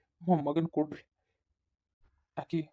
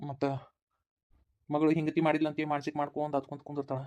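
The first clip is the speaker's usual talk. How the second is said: ordinary talk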